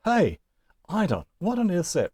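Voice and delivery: high, baffled exclamation; mock bewilderment at a strange word; puzzled student. Teacher mimics a confused student meeting eidon. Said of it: "you look at it and say".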